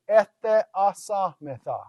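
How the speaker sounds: "Etheasametha" slowly, with e and a kept apart; slow, evenly divided "Etheasametha"; syllable by syllable. Shown as a demonstration. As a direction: sound out "Etheasametha" as e-the-a-sa-me-tha. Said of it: "you want to separate the two".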